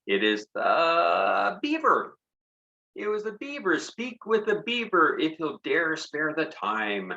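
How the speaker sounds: high, slow storybook reading; poem reading. A narrator's reading. Teacher reading a poem to young children, slow and high. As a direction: read it out like a spooky tale for kids.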